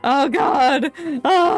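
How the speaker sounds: ordinary talk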